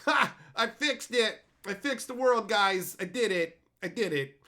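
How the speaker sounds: high, loud gloating; hammed-up triumphant writer; smug gloating mockery. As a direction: crow over a fake victory. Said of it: "some guy who's like".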